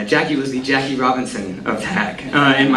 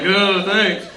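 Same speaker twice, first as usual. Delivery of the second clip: grudging thanks; 6 semitones higher than usual. Retold or imitated. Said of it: "you know, like".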